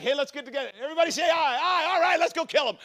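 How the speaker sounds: high, loud, rallying call; eager mock crowd. Acting out a crowd agreeing on murder, high eager rallying. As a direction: rile everyone up in a mock rally.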